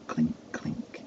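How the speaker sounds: hushed, voiceless little clinks; imitated coin clinks. Boy overhears coins dropping into hands, hushed clinking. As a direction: whisper the sound of each coin falling.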